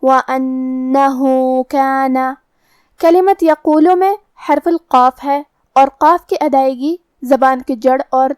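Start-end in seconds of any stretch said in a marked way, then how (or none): 0.0-2.2 slow tajweed وَأَنَّهُ, held hum on ن, long final "hoo"; lengthened madd. Shown as a demonstration. Teacher showing the two-count madd, slow lengthened هُ in وَأَنَّهُ. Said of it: "ہم اپنی آواز دو مد کے برابر لمبی کریں گے".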